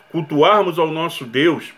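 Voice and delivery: ordinary talk, nothing marked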